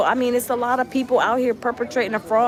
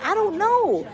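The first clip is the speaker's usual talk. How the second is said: baffled; 11 semitones higher, slower than usual. Retold or imitated. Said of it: "I was like".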